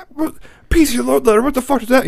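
high-pitched imitation of a panicked, scolding dad; mock frantic scolding; freaking-out dad. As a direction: mimic dad demanding answers, voice way up. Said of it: "he's freaking out".

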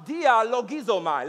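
"dialogizomai" slowly, loud and clear; slow teaching pronunciation. Shown as a demonstration. Preacher teaching the congregation a Greek word, "dialogizomai" said slowly. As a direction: stretch out "dialogizomai" for learners. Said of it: "Let's say it together".

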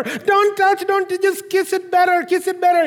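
put-on high pleading little-boy voice; whining child. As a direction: beg like a hurt five-year-old. Child pleading about hurt hand.

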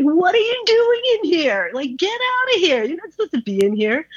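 high-pitched mock yell ordering him out; retold yelling. Ordering the coworker out of her bedroom, high mock yell. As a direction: chase him out with a high, indignant voice. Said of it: "I just started yelling at him".

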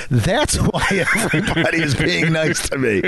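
high, laughing mock dawning; laughing realization. [name] retelling his realization about the girls, laughing. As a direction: laugh as it finally clicks. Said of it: "I'm like".

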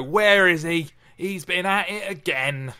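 loud mock demand in a pub; Bill storming into the local pub, loud; pub shout. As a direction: burst in loudly hunting someone. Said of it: "he'd walk into the local pub and go".

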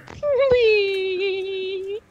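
high squeaky please; mock plea. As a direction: whine it in a cartoon voice. Mocking plea for Roger.

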